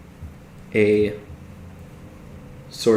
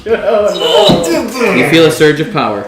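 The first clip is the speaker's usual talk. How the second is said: dramatic, drawn out; 7 semitones higher, 7 dB louder, much slower than usual. Acted. GM dramatic ominous narration.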